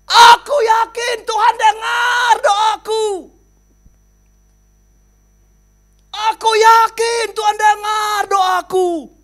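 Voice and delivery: high, slow, loud shout of faith. Acted. Old man on the roof shouting to the helicopter crew. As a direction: scream it up at the helicopter. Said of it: "Dia teriak".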